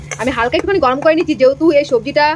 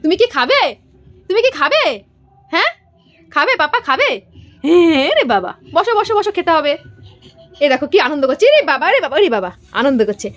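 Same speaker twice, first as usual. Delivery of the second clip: high singsong coaxing, much higher than her usual voice; 4 semitones higher than usual. Real, not acted. Mother coaxing little Sanvi to come and eat, high playful baby talk.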